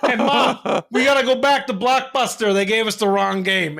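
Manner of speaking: high, loud Italian-style complaint; Italian accent. Retold or imitated. Kid complaining to Mom about wrong game, Italian accent. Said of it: "I turned into a fucking Italian".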